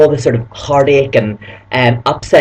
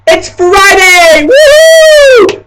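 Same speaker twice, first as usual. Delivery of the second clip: much higher, louder, slow cry of joy; excited shout; 7 dB louder, much slower than usual. Acted. Announcing Friday to her viewers, shrieking with joy. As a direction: belt it out like a party cheer.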